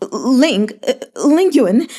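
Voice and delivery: terrified pleading. A narrator's reading. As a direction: beg for mercy in mild hammed-up terror. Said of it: "weeping in terror".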